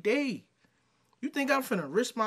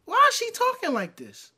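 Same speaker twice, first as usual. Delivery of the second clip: much higher, louder, fed-up cry; exasperated shout; 13 semitones higher, 5 dB louder than usual. Real, not acted. Man reacting to her voice in the clip, exasperated high yell. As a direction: throw the question up in disbelief.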